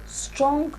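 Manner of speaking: Strong pitched high and rising, set apart; stress on strong. Real, not acted. Lecturer showing what strong syllables are, stressing Strong. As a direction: lift the word Strong up alone.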